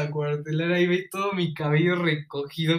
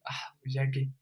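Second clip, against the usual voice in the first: dejected sigh; 3 semitones lower, 7 dB quieter than usual. Retold or imitated. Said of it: "todo agüitado".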